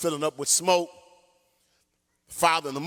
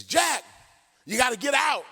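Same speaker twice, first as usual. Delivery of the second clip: urgent call; 7 semitones higher than usual. Retold or imitated. Father shouting up to son.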